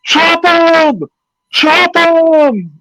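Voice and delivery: high, loud mock yell from the stands; crowd yelling. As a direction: imitate a buddy yelling from the crowd. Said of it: "he was sitting in the crowd yelling".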